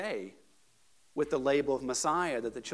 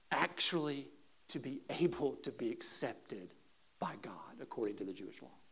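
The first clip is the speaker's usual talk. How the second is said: hushed emphasis; 6 dB quieter than usual. Real, not acted. Preacher explaining acceptance by God, hushed. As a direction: sink into a near-whisper.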